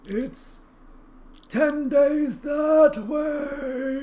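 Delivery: drawn-out high river spirit; slow booming river voice. Acted. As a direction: speak as an ancient river, stretched slow.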